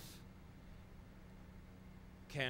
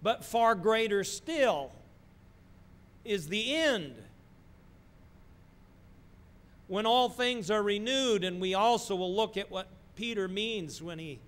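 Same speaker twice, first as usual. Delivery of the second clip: soaring preacher's voice, higher and louder; 7 semitones higher, 8 dB louder than usual. Acted. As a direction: build to a joyful climax. Preaching builds to climax.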